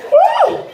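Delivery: a high loud whoop, head spinning; dizzy woo. Retold or imitated. Comedian acting out dizziness, high loud whoop. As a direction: yelp like the room just tilted. Getting dizzy bending over.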